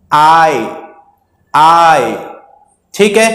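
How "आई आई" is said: आई held as a long eye; long vowel sound. Shown as a demonstration. Teacher demonstrates the long sound of I, drawn-out आई. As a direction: draw out the glide in आई. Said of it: "long sound है".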